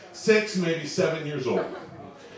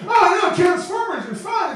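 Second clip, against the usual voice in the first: put-on excited kid; 12 semitones higher than usual. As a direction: squeal it like a caught-out little boy.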